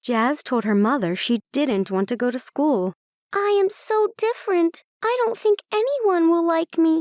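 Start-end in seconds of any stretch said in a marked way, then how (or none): 3.3-6.9 put-on high, fast young voice, upset; a worried little unicorn, pitched high and rushed; high child voice blurting out her fear. Jazz complains to mother.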